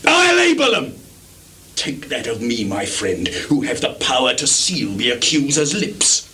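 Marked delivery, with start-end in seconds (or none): 0.1-1.0 high loud declaration; insistent shout. Acted. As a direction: slam the word none down. Lear insisting wildly.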